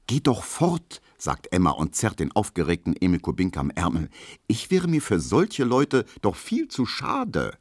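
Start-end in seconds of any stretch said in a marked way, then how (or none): 0.1-1.0 high-pitched urging; haughty urging. A narrator's reading. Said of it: "Emma ist nämlich sehr vornehm". 4.5-7.6 slow, high-pitched snootiness; haughty. A narrator's reading. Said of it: "Emma ist nämlich sehr vornehm".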